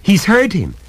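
frightened, urgent outburst; horrified cry. A narrator's reading. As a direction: cry out as if hearing something dreadful. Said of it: "she cried".

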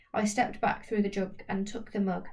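plain reading voice

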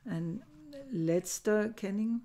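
normal talking voice

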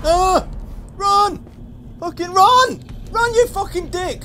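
very high, full-volume screaming; screaming in panic. Real, not acted. Player urging himself to run from a monster, screaming. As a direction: scream to flee for your life.

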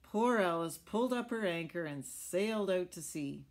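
plain reading voice

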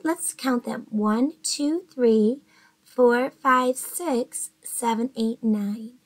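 usual speaking voice

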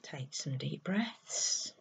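soft, slow meditation voice with long pauses; guided meditation. Acted. Guiding listeners to close their eyes and breathe, soft meditation voice. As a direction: ease them gently into stillness, leaving space to breathe.

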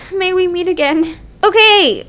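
ordinary delivery

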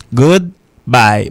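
GOODBYE drawn out in two separate words; careful slow-motion "Goodbye" for learners; slow careful pronunciation. Shown as a demonstration. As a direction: say "Goodbye" slowly, good, then bye. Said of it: "Mais on doit parler vite".

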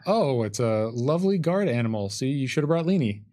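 ordinary delivery

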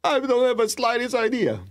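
high carefree answer to a student's question; cheerful admission. Retold or imitated. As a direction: toss it off lightly, unbothered. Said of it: "I go".

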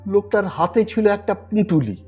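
ordinary delivery

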